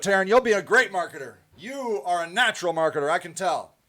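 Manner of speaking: ordinary talk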